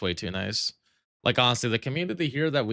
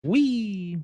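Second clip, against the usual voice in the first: high squeal; 11 semitones higher than usual. Pretend. Flying down, squealing.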